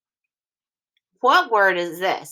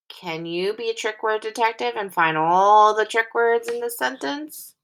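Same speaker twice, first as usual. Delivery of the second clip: ordinary delivery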